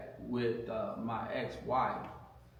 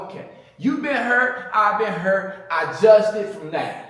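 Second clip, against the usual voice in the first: higher and louder, animated quote; excited retelling; 8 semitones higher, 11 dB louder than usual. Retold or imitated. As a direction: replay the pickup line with gusto, laughing at the end. Retelling his pitch to her.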